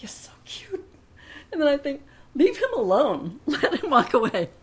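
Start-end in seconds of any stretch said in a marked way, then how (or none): 0.0-0.8 soft, tempting whisper; hushed cooing inner voice; shoulder-devil whisper. Retold or imitated. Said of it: "angel and devil on my shoulder". 2.3-4.5 loud, raised-pitch order to oneself; emphatic inner voice laying down the law; shoulder-angel scolding. Retold or imitated. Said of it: "angel and devil on my shoulder".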